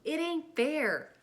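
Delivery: put-on boy crying out that life is unfair; passionate cry. A narrator's reading. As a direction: wail it out at the injustice. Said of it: "I cried passionately".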